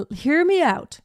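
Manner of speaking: ordinary talk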